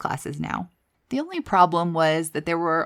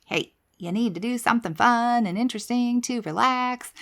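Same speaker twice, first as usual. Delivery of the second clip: higher, put-on voice of a nagging brain; coaxing brain voice; 5 semitones higher than usual. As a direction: playfully urge yourself to go have fun. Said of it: "my brain was basically saying to me".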